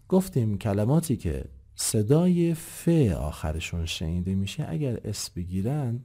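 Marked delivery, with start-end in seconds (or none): none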